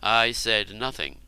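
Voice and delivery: usual speaking voice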